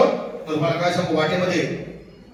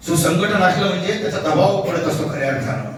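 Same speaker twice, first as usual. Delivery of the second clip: ordinary talk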